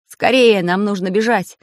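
urgent loud call; shouted. Acted. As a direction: shout it as you leap. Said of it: "выкрикнул Самоделкин". (громкий тревожный выкрик)

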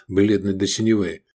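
ordinary delivery, nothing marked

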